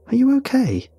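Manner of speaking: very high, halting question; stuttered. A narrator's reading. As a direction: ask in a startled high pitch. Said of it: "I stuttered".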